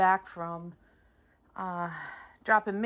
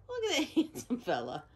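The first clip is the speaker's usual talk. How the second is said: higher, softer, with a laugh inside; laughing admiration; 5 semitones higher, 6 dB quieter than usual. Real, not acted. Owner admiring handsome dog, laughing pet talk. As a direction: chuckle through praise for the dog.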